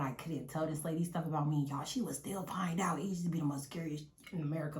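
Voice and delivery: hushed confiding whisper. Real, not acted. Sharing a secret with friends, in a whisper. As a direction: whisper it like a secret about mom. Confiding about her mom.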